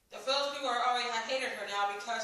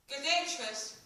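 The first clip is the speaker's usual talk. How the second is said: ordinary delivery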